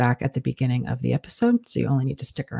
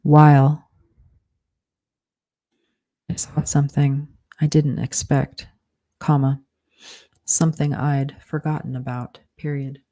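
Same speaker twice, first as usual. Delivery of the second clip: slow dictation, punctuation spoken aloud; dictating; slower than usual. Shown as a demonstration. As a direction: dictate it word by word with pauses.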